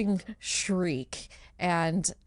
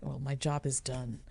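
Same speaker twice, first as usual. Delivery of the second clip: offhand deity; 5 semitones lower, 7 dB quieter than usual. Acted. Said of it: "Karen is like".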